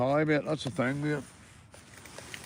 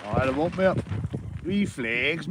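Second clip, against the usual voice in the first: ordinary talk, nothing marked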